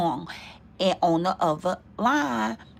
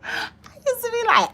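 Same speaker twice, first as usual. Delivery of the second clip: ordinary talk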